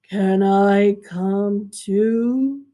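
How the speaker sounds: slow, low, drawn-out moose voice; a big lumbering moose pleading; moose asking. Acted. Moose asks the girl in the canoe, slow and low. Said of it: "Moose lifted his head".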